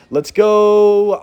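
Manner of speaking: drawn out. Real, not acted. Gearing up mid-battle.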